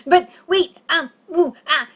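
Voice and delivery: high, loud broken stammer; flustered protest. Retold or imitated. Client trying to stop therapist, flustered protest. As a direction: try to cut in with sputtered bursts.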